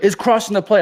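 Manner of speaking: high, loud mock umpire protest; retold yelling. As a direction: argue back like a yelling umpire. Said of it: "the umpire's starting to yell".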